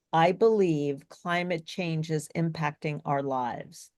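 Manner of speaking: slow, relatively flat delivery; dull, held-down reading at a slow pace; flat, boring read. Shown as a demonstration. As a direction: read it evenly with no excitement. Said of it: "There wasn't a lot of excitement in that".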